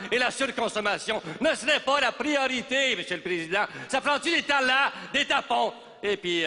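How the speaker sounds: high-pitched, worked-up political tirade; hammed-up outrage, pitch soaring; indignant rant. Politician railing at les jeunes de demain before the President, indignant rant. Politician ranting at the young protesters.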